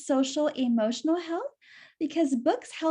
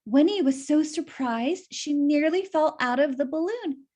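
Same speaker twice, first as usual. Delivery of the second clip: ordinary delivery, nothing marked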